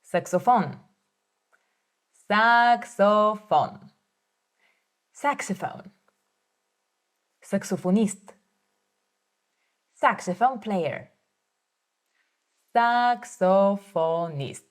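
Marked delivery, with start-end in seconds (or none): 2.3-3.9 Saxophone said slowly, syllable by syllable; slow demo. Shown as a demonstration. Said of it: "teacher models word slowly". 12.8-14.6 Saxophonist said slowly, syllable by syllable; slow demo. Shown as a demonstration. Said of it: "teacher models word slowly".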